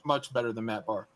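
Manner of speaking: ordinary talk, nothing marked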